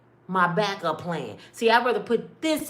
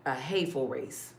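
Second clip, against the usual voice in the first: low, soft bitter repeat; quiet disgust; 6 semitones lower, 6 dB quieter than usual. Real, not acted. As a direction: mutter it with disgust.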